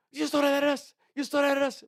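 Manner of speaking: high, loud yelling in broken English; mock angry scream. Angry guys outside the bar scream at him, high and loud. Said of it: "they're screaming at me".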